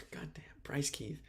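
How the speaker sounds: exasperated whispered aside; self-scolding whisper. Real, not acted. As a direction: breathe it out under your breath, annoyed at yourself. Annoyed at own wrong notes.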